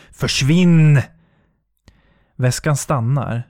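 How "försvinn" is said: loud, desperate "go away"; loud commanding yell; fierce shout. Acted. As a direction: banish it with full voice. Shouts at the suitcase.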